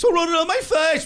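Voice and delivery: high, loud indignant sitcom neighbour; Newman. Acted. Neighbour demands to know who wrote on his face, Newman impression. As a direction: shout it in a high, outraged voice. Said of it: "the guy who plays Newman".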